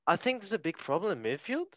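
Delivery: high, swooping mock Scouse that lilts like a question; Jamie Carragher. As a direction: try a shaky-grasp Scouse accent, questioning tune. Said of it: "sound like a question as a scout".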